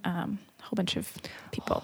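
whispered trailing list. Real, not acted. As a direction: let it fade into a whisper.

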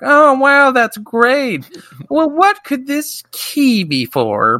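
high, sing-song aunt voice. Retold or imitated. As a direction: chirp it like a sweet old aunt. Voicing the aunts.

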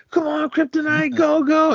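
high, excited mock cheer for the horse; imitated track cheering. Eddie Mush cheering Kryptonite at the track, high mock cheer.

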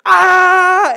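sharp high mock scream of fright; a short, startled, high yell; retold childhood scream. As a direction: let out a kid's terrified yelp. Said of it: "I was like".

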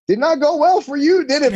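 high, teasing imitation of the professor; playful mock professor voice; instructor's voice. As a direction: rib him as the old professor. Voicing instructor's teasing question.

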